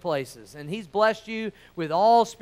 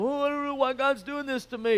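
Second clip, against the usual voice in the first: softer, self-pitying mock whine; mock whining; 3 semitones higher, 4 dB quieter, faster than usual. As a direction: whine it like a sulking believer. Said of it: "that kind of attitude of".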